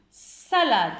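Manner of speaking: "salade" with a long s and a loud "sa"; stressed first syllable. Shown as a demonstration. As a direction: hit the opening "sa" of "salade". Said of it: "c'est la syllabe sa". (« salade » avec « sa » très appuyé)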